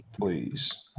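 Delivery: breathy whispered please, voice dropped away. Acted. Host whispering please to the chatbot, unvoiced whisper. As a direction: whisper please as a tiny begging aside. Pleading please after the prompt.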